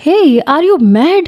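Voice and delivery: high, slow angry yell; angry shout. A narrator's reading. Girl yelling at the stranger who grabbed her, angry. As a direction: snap at him in anger. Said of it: "انائزہ غصے سے چلائی".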